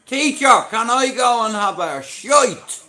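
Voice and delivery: put-on high, loud cheeky kid asking teacher; schoolkid voice. Kid begging the teacher for the toilet, high and loud. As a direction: squeal it like a cheeky pupil.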